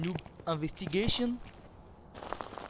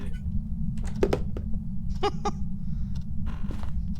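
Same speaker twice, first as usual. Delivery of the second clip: squealing high laugh; high-pitched laugh. Real, not acted. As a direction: burst into a shrieking laugh. Loud laugh after scare.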